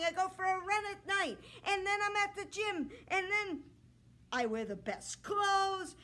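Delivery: a cocky prince showing off; boastful suitor. Acted. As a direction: puff up like a vain young man. Suitor keeps bragging.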